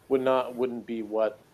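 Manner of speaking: ordinary talk, nothing marked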